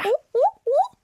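high, loud hooting seal calls; imitating seals. [name] tries to copy the seals on the speaker, high loud hoots. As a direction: bark out a clumsy seal imitation. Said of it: "ich kann das nicht so gut. Das klingt wie ein Affe".

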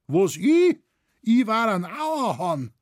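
high, loud astonished outcry; aghast disbelief. A narrator's reading. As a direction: blurt it out in shocked disbelief. Storyteller voices the stunned shopkeeper.

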